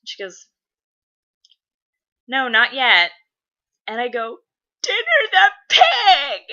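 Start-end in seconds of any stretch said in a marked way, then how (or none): 2.3-3.1 louder, higher imitation of a mom; lightly voiced mom, matter-of-fact but loud; oblivious mom. Mom misunderstanding her tearful daughter after checking watch, loud answer. 4.8-6.4 slow, high mock wail; drawn-out shriek of an upset kid; tearful child scream. Girl correcting mom about the eaten pig, loud hammed-up wail. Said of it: "I'm in tears".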